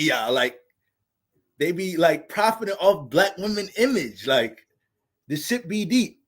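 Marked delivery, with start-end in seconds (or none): none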